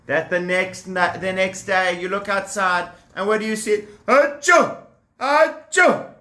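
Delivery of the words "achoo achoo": high loud pretend sneezes; cow sneeze. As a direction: sneeze twice like a sick cow. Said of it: "goes the cow".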